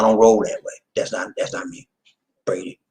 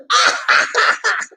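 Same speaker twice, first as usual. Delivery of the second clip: loud, very high burst of laughter; high cackling laugh; 3 dB louder than usual. Real, not acted. Laughing after calling all aboard, a high cackle.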